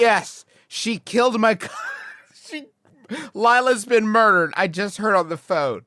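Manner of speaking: barely holding back a laugh. Real, not acted. Still laughing while quoting daughter's news.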